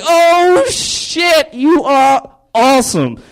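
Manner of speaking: very high, awestruck squeal; freaking out. Acted. Disciples seeing Jesus's miracle, hammed-up high amazement. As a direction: freak out in amazement, stretching the last words. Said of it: "His disciples are freaking out".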